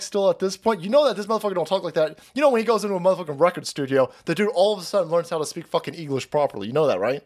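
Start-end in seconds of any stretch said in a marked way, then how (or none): none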